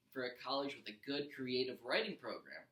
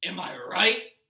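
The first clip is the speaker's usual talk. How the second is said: louder, higher, pushing for agreement; loud repeated taunt; 4 semitones higher, 12 dB louder, slower than usual. Acted. Gabe repeats his question to Eddie, loud and pushy. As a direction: press Eddie to back him up. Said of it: "Gabe repeated".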